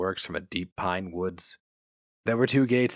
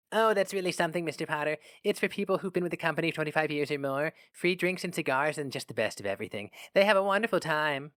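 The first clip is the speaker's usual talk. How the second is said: higher, quick enthusing secretary; gushing secretary; 6 semitones higher than usual. A narrator's reading. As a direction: gush about the free drinks. Secretary gushes about club.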